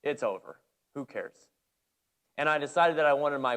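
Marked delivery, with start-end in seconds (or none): 0.0-1.5 low, soft gentle rebuke; calm yourself from within; inner voice. Retold or imitated. Holy Spirit calming the angry coach, low soft voice. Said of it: "the Holy Spirit kinda came on me and said".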